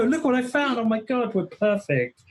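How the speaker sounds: high-pitched gushing discovery; mock excited. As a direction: squeal it like a thrilled partner, hammed up. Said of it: "And I go".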